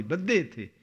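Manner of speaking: usual speaking voice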